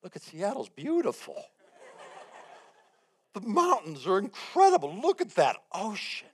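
high-pitched, swelling awe; acted wonder. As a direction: gush over the view, drawing it out. Acted awe at view from plane.

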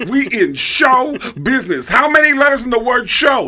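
high, loud scolding; loud comic tirade; Tracy Morgan impression. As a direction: berate him in a high shouted mimicry. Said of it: "And he goes".

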